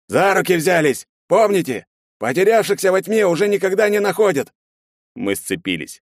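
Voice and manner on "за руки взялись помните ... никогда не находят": high, loud squad orders; командный крик. A narrator's reading. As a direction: shout orders and a warning. Командир отдаёт приказ отряду. (громкий приказ командира)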